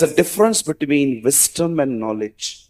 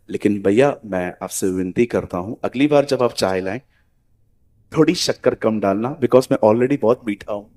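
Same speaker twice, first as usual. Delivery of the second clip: softer, lower, kindly request; polite gentle tone; 5 semitones lower, 3 dB quieter than usual. Shown as a demonstration. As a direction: thank him sweetly, then ask gently for less sugar. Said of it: "अब देखो मैं कैसे बोल रहा हूं।". (नरम, विनम्र, प्यार भरा लहजा)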